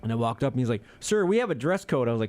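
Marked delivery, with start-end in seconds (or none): 1.0-2.1 high officious greeter voice; mock rule-quoting greeter; stern greeter. Greeter citing the dress code to him, officious.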